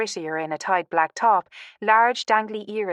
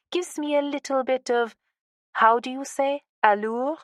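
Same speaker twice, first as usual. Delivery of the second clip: higher-pitched put-on French accent; fake French accent; 6 semitones higher than usual. A narrator's reading. Woman joking about her French stage name, fake French accent. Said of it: "added with a French fake accent".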